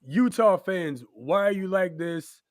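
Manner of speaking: high, loud pleading call to the fans; exasperated plea. Pretend. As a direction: beg the fans to explain themselves. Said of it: "That's what I was trying to say".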